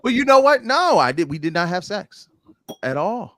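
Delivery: high, loud, defensive denial; loud protest. Real, not acted. Denying it to the cohost, a high loud protest. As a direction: push back hard and high.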